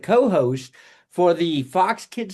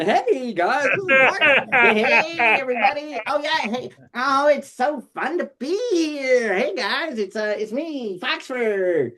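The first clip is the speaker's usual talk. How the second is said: put-on higher, bright-eyed puppet host greeting the kids; Foxford puppet voice; 5 semitones higher than usual. Puppeteer [name] introduces his puppet to the host, in a high eager puppet voice. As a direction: play the eager fox puppet saying hi. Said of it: "so then there was Foxford".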